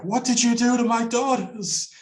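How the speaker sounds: high, anguished demand; grieving mother. Retold or imitated. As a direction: cry it out as a mourning mother. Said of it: "she goes like".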